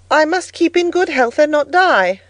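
put-on high, emphatic little girl; earnest child. A narrator's reading. Girl giving an objectionable answer about avoiding hell, high and earnest. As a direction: declare it up high with a child's logic.

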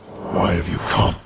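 a council elder booming at the intruder. A narrator's reading. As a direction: boom it down from the dais. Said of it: "a booming voice rang out".

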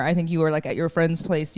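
usual speaking voice

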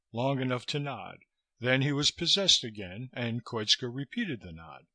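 plain reading voice

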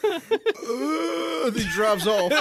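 dazed slow voice. Retold or imitated. Imitating guy on air duster.

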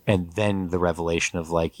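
ordinary delivery

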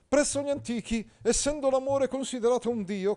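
high, loud pompous knight; Agilulfo pompous. Acted. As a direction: declaim like a stiff courtly knight. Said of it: "continuava Agilulfo fitto fitto". (Agilulfo, cavaliere pomposo, acuto e forte)

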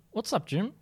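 ordinary delivery

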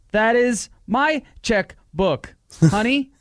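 high, loud, slow stilted line; overacted wooden delivery; bad acting. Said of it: "Worst acting ever".

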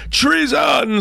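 very high, contained scream; screamed accusation. A narrator's reading. Archduchess echoes the king's accusation, screaming high. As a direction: scream it out at top pitch. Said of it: "screamed the Archduchess Anna Pest".

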